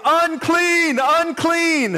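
high, loud yell of a warning cry; lepers' loud cry, falling at the end. Acted. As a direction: call it out across the road, high and loud. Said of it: "walking to the temple yelling".

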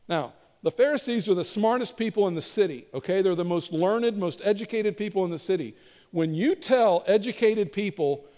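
normal talking voice

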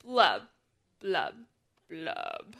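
low glum blub; gurgling fish man croak. Acted. Fish bubbles in the story.

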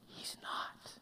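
near-silent whispered answer; whispering. Real, not acted. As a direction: whisper it like a secret. Hushed punchline to rhetorical question.

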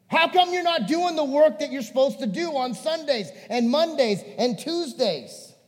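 loud, high-pitched rebuke; exasperated preacher-style telling-off; frustrated Jesus. Acted. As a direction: berate the congregation, hammering each accusation. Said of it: "Here's what it would sound like".

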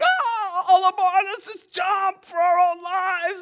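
hammed-up panicked yelling, very high; flipping out. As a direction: play terrified sailors in a loud, comic shriek. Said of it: "the guys are flipping out".

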